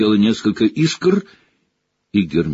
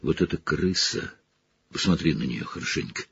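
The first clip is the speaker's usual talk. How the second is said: softer, fast, pressing; low urgent plea; 4 semitones lower, 8 dB quieter than usual. Acted. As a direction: push it out low and quick.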